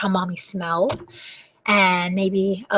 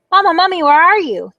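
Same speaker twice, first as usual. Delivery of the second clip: put-on higher, louder little child call; 7 semitones higher, 7 dB louder than usual. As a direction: call out like a lost little kid. Baby mummy calls out.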